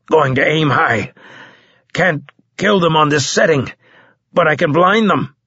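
urgent, strained comrade under fire; wounded soldier. A narrator's reading. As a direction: push out the battle plan with effort. Wounded soldier announcing last-ditch plan.

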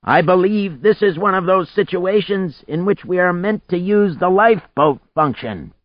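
shouting. A narrator's reading. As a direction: call it into someone's ear over a storm. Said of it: "Sax leaned over to shout again".

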